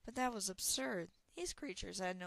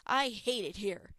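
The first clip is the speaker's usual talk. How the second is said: loud bitter roar; 8 dB louder than usual. Acted. Dragon roars its homesickness, loud. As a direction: bellow the misery. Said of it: "the dragon roared".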